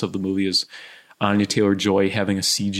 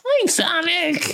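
cartoon high voice; slower than usual. Cartoon voice bit.

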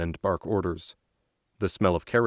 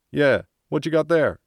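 loud, higher shout; calling back; 7 semitones higher, 6 dB louder than usual. Acted. Soldier calls back from bus.

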